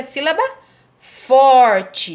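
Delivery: "sílaba" rising, a pause, then loud stretched "forte"; pause, then punch "forte" in "sílaba forte"; "sílaba... FORTE", slow and strong on the last word; emphatic answer. Shown as a demonstration. Teacher answers with emphatic term.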